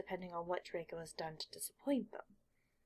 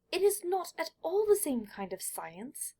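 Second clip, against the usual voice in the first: high, loud, indignant protest; shrill boy correcting his mother; shrill insistence, pitched high and loud; 8 semitones higher, 7 dB louder than usual. Acted. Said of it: "he says shrilly".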